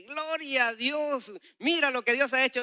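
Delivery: loud, high, drawn-out exclamation of joy; exultant and high, stretching the praise; jubilant cry of praise. Retold or imitated. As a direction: cry it out in overjoyed amazement. Joyful cry on seeing man walking.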